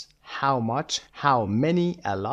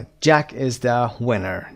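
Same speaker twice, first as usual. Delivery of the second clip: slow model reading; 5 dB louder, much slower than usual. Shown as a demonstration. Teacher models English sentence.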